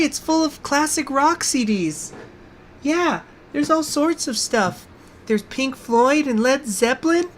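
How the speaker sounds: put-on high, sweet little girl luring a stranger into the van; mock little girl offering goodies from a van. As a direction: coax him in like a creepy little girl. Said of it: "like, a little girl voice".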